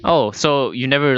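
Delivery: normal talking voice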